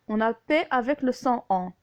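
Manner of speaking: ordinary talk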